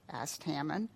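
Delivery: usual speaking voice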